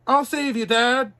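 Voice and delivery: high, loud desperate shout; a loud heroic cry. Acted. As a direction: shout it as you leap in. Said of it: "cried Pinocchio".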